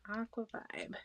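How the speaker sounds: whispered label read; whispering. Real, not acted. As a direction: breathe the name out softly. Reads label to herself.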